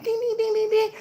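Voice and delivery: high, soft sing-song dings; high dinging. Acted. Reader mocking own forgetfulness, high soft dinging. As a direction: imitate a little bell, high and quick. Acts out scatterbrained chiming.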